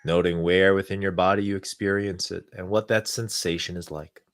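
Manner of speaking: calm, unhurried guidance with long pauses. Acted. Leads a guided meditation.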